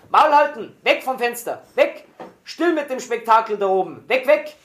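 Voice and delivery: high-pitched barked orders; shrill commanding. A narrator's reading. Soldiers below order prisoners away from the windows, shrill shouting. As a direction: yell short, piercing commands. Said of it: "Grelles Kommandieren sprang von unten herauf".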